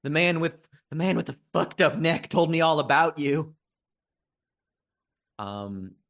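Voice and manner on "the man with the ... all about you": high, scared accusation; frightened accusation. Acted. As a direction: spit it out in fear, voice up high. Panicked gunman accuses.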